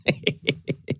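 soft, short chuckling; a quiet burst of giggles; laughter. A narrator's reading. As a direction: laugh lightly under your breath. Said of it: "חלק מהחברים התחילו לצחוק".

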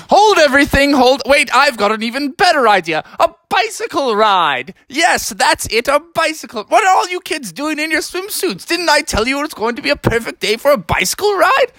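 high, bright-eyed burst of a new idea; excited dad voice. Acted. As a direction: pitch it up like a dad who just had a great plan. Father Beaver excitedly proposes bike.

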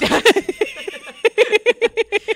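real high, loud burst of laughter; loud laughing. Real, not acted. Co-host cracking up at the accent debate, loud laughter.

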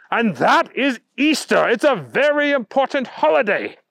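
high, emphatic outburst; yelling. A narrator's reading. Bunny finishing his lecture on Easter, worked-up yell. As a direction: hit the key words hard, worked up. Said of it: "yelled the Easter Bunny".

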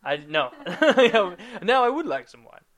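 laughing yes to the wine; laughing give-in. Real, not acted. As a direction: cave in through a laugh, giggling. Jokingly caves on the wine.

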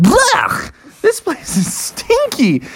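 high, slow, hammed-up revulsion; disgusted. Family reacts to the stinky trash, disgusted. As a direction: recoil from a foul stink.

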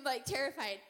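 normal talking voice

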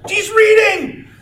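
high, slow laughing shout; excited shout. Retold or imitated. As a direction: yell it out with glee, laughing. Grandfather voiced in story.

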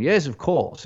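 put-on a light, playful stab at a British accent; hint of a posh British reply; mock British accent. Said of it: "get a British accent with you".

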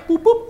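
high, loud "boop, boop"; robot boot-up beeps. Pretend. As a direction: voice a little machine's beeps. Imitates a computer beep on entrance.

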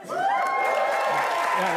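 squeaky high "yeah" sliding up; a giddy falsetto whoop; falsetto squeal. Real, not acted. Plugging his movie, a giddy high squeal.

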